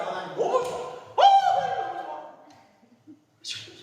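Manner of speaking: can't hold the joy in; joyful shout. Shown as a demonstration. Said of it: "기뻐서 소리 질러요".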